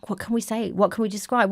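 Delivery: soft, hushed questioning; timid asking. Retold or imitated. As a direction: voice people afraid to ask. Voices people afraid to ask.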